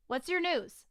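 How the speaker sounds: ordinary delivery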